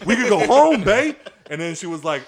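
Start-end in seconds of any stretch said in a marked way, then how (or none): 0.1-1.1 high, loud laughing line; bursting with glee; giddy laughing announcement; laughing excited. Retold or imitated.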